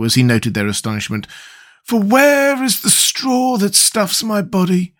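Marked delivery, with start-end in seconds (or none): 1.8-4.9 slow, higher, mournful Scarecrow voice; ruined Scarecrow. Acted. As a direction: stretch out the despair. Scarecrow laments.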